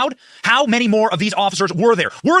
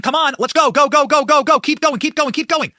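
raised, quickened chant of go go go; retold shout; 5 semitones higher, 3 dB louder than usual. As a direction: call it out like urging a crowd on, half-shouted.

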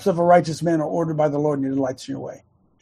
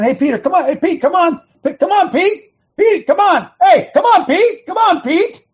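high, loud, rapid-fire coaxing, building higher; hammed-up pestering, calling someone over and over; insistent repeated calling; 12 semitones higher, 5 dB louder than usual. The Lord supposedly nagging Peter to come, mock insistent. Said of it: "Did the Lord keep saying".

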